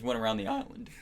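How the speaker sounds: high cracking protest. Real, not acted. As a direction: let the voice crack upward. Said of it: "your voice is cracking".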